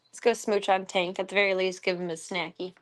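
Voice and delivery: ordinary delivery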